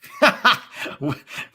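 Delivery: loud, high burst of laughter; laughing. Real, not acted. Streamer amused by a chant idea, bursting out laughing. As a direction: crack up at your own idea.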